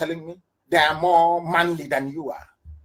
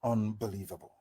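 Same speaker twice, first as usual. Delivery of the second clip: low, very soft mutter; stunned disbelief; 9 semitones lower, 13 dB quieter than usual. Real, not acted. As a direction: say it under your breath, appalled. Stunned disbelief, first take.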